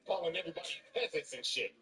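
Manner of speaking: ordinary delivery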